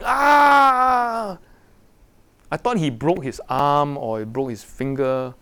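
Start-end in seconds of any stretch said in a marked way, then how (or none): 0.0-1.4 high, loud exaggerated "ah" cry; imitated shout of pain. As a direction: ham up a dramatic pain scream. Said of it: "And he was shouting".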